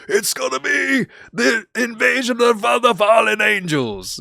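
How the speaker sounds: high, loud, slow hammed-up panic; mock alarmed shout. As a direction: parody his own overexcited warning. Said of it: "And I'm over here like".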